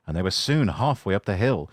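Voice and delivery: usual speaking voice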